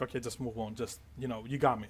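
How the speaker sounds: quiet, low, offhand surrender; surrendering guard. Acted. Second guard waves the player on, quietly resigned. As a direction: give up softly without a fight. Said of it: "raises his hands up and is like".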